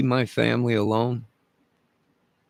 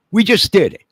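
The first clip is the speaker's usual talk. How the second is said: much higher, louder triumphant shout of "We just did it."; defiant yell; 10 semitones higher, 10 dB louder than usual. Real, not acted. Ranting man yells triumph at authorities, full shout. Defiant boast repeated, yelled.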